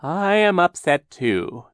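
slow, high, hammed-up mourning; mournful voice. A narrator's reading. Captain Sham feigning mourning for Aunt Josephine, slow and hammy. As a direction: fake grief, laid on thick. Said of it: "began to speak in a mournful voice".

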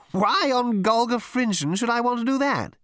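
high, airy, unbothered query; high puzzled captain. A narrator's reading. Captain in his bath asking why he should bother. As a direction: ask it lightly from the bath. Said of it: "he asked".

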